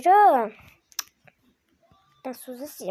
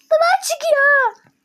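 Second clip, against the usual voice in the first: much higher, louder, slow stretched yell of duman; drawn-out shout; 12 semitones higher, 5 dB louder, much slower than usual. Real, not acted. Repeating the smoke warning to her partner, slow drawn-out shout. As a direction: drag the warning out loud.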